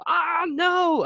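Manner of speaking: high-pitched wail of defeat; mock dismayed cry. Player retelling losing to kids at Fortnite, high dismayed cry. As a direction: cry out as if just killed in-game.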